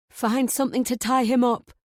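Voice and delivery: high, loud urgent command; wife ordering her son urgently. A narrator's reading. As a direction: bark the order. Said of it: "she orders Harry".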